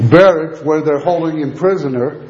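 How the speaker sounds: normal talking voice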